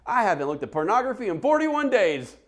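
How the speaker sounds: high, loud mock announcement; over-eager blurting. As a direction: blurt a confession like a proud oversharer. Stranger blurts out a confession.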